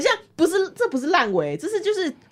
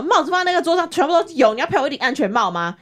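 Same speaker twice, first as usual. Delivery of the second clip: higher, fast mock yell of an angry stranger; retold angry outburst; 6 semitones higher, faster than usual. Stranger at the buffet demanding she pay for the helmet, mock angry yell. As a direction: snap it out fast like he did.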